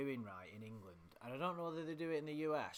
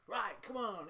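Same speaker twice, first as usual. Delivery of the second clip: high, loud rallying call in imitation; louder, raised-pitch mimicry; mocking loud people; 9 semitones higher, 5 dB louder than usual. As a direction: chivvy everyone along, mockingly. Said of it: "it's people, like".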